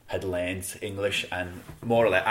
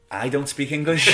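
raised pitch, louder, breaking into laughter; laughing punchline; 6 semitones higher, 7 dB louder than usual. Real, not acted. Traveller confessing his own accent problem, laughing. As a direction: land the self-mocking punchline through a laugh.

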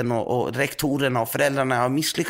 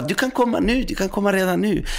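much higher imitated voice; friend's eager reply; 9 semitones higher than usual. Friend inviting the preacher over, in a high eager voice. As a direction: pitch it up like an eager pal. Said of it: "Så han bara".

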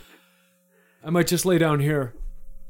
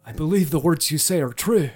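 ordinary delivery

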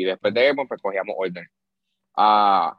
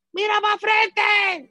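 much higher, slow drawn-out shouted order; sergeant yelling; 3 dB louder, much slower than usual. Retold or imitated. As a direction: bellow it up from the ground like a drill sergeant. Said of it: "El sargento de abajo gritándome".

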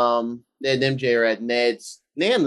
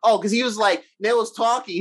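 usual speaking voice

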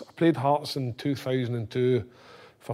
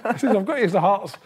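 excited pleading; 7 semitones higher than usual. Retold or imitated. Desperate player begging agent about Hearts, excited.

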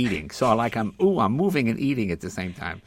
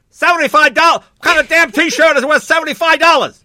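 very high, loud outraged yell; screaming dad; 14 dB louder than usual. Retold or imitated. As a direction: scream it at the shop clerk over the price. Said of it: "screaming at the guy".

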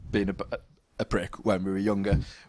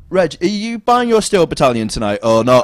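loud, higher mock call across the shop; 7 semitones higher, 9 dB louder than usual. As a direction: call it in from the door. Colleague calling in.

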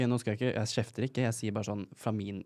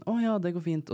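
higher, breezy mock agreement; cheery self-quote; 9 semitones higher, 3 dB louder than usual. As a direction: chirp it out easily, pitched up. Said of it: "var jeg sånn". (lett munter etterligning av seg selv)

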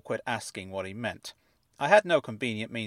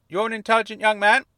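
high, fuzzy voice like it's underwater; fuzzy fish tank voice; 10 semitones higher, 8 dB louder than usual. A narrator's reading. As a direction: speak as if from inside a fish tank. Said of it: "as though he were speaking from the inside of a fish tank".

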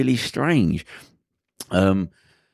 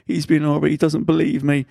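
high-pitched mock tearful complaint; imitated crying girl; 7 semitones higher than usual. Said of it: "and she's crying".

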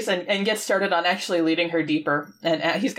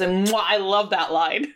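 usual speaking voice

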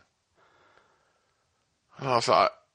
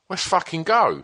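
higher, squaring up for a fight; mock fight call; 9 semitones higher than usual. Drunk narrator squaring up to the manager, mock fight call. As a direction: throw down the challenge. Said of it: "And I was like".

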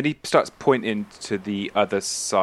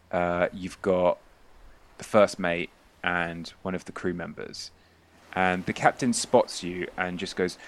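ordinary talk

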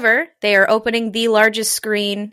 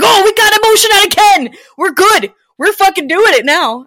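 loud, high jubilant outburst; excited shout; 11 semitones higher, 7 dB louder than usual. Retold or imitated. Child reacting to dad's rare excitement, jubilant shout. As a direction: shout it, thrilled and urgent.

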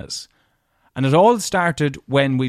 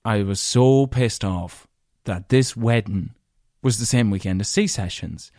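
normal talking voice

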